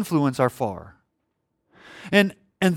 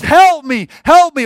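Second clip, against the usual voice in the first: high, loud battlefield yell; wounded soldier's loud cry; yelling for help; 8 semitones higher, 9 dB louder than usual. Acted. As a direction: shout urgently to friends under fire. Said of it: "he's yelling to his friends".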